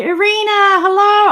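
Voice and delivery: high, loud happy hello; light up at a friend; delighted greeting. Real, not acted.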